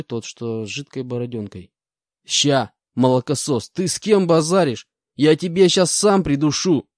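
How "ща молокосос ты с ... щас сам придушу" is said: loud, slow menacing bellow; yelled threat. Acted. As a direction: roar a threat at the kid. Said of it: "проорал бес".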